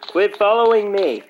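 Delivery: high, loud annoyed yell; angry. Real, not acted. Gamer telling another player to back off, angry. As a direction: snap loudly at the person tailing you. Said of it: "Why are you so angry?".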